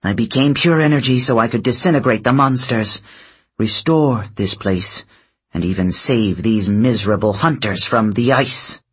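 usual speaking voice

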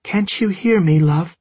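high, loud, otherworldly plea; ghostly plea. A narrator's reading. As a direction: call out desperately from beyond. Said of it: "The Jumbly Girl stopped".

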